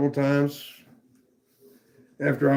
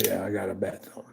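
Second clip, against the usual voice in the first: whispered and softer, self-pitying; whiny whisper; 6 semitones lower, 9 dB quieter than usual. Real, not acted. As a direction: whine it under your breath. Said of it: "I'm whining like a little bitch".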